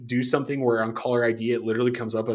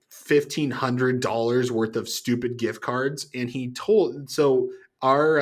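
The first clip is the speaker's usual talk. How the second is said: normal talking voice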